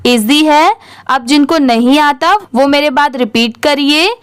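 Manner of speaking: normal talking voice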